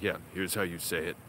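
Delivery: low-pitched imitation of a menacing tutor; murderer-like tutor impression. Tutor correcting his student on the phone, low and menacing. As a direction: drop the voice low, like an unfriendly tutor. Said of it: "he was sounding like, uh, like a murderer".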